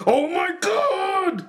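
high, slow, stretched-out wail; exploding cry. Retold or imitated. Garter Belt exploding out of nowhere, high slow wail. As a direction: draw out a comic dying wail. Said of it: "explodes like".

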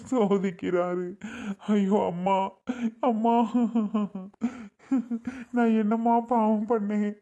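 tearful lament breaking into sobs; crying. Acted. Husband lamenting that God tests only him, crying. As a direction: weep through a complaint to God. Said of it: "அழுகாதீங்கங்க".

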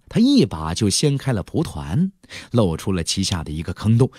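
ordinary delivery, nothing marked

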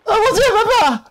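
very high mock-terrified wail; panicked cry. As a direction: cry out for dad in hammed-up panic.

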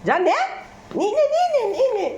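high, laughing coaxing to the birds; sing-song calling the birds to take it. Real, not acted. Tossing food to birds, coaxing.

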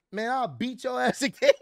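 high, laughing mock threat; retold trash talk breaking into a laugh. Wrestler threatens rival mid-brawl, high gleeful trash talk. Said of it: "he talking trash to Roman".

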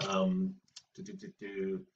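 soft do do do, tapping out a beat; meter demo. Shown as a demonstration. Teacher sounding out meter for the class, trailing off softly. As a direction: hum the meter quietly under your breath. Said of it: "the meter is the regular pattern of stressed, uh, and unstressed syllables".

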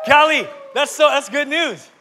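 high, loud burst of delight, then easing; thrilled preacher's outburst; excited exclamation. Real, not acted. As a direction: burst out amazed at the news. Exclaims over the verse just read.

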